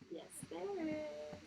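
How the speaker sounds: slow, drawn-out yes there is; slow stubborn child's reply; slow insistence. A narrator's reading. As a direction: stretch it out, stubborn. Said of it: "said Stephanie".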